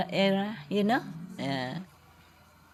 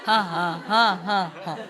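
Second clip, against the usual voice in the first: laughing on purpose. Pretend. Teacher turns the crying into a deliberate ha ha laugh. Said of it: "I just wanna laugh".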